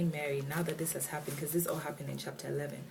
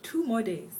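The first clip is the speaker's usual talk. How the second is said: "Two" pitched high and loud, falling through "more days"; high, loud punch on "Two", then a steep fall; emphatic repetition; 7 semitones higher, 6 dB louder than usual. Real, not acted. Repeats the surprising delay.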